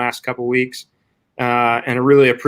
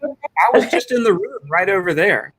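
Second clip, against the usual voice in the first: mouth full; 7 semitones higher, faster than usual. Real, not acted. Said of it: "with a mouthful of food".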